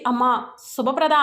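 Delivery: ordinary delivery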